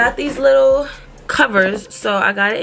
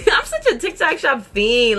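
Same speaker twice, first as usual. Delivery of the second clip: laughing; 10 semitones higher than usual. Real, not acted. Laughing at herself.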